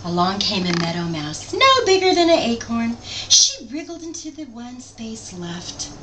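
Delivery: lively picture-book read-aloud; story narration. A narrator's reading. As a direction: read it to a child, animated.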